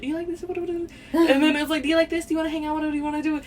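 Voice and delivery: high-pitched rattled-off questions, run together; speed talking. Retold or imitated. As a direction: imitate a kid babbling questions at a stranger. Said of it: "speed talking to her".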